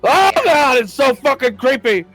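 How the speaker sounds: loud, high-pitched cry of revulsion; creeped-out outburst. Pretend. Player reacting to the game master's creature, creeped-out outburst.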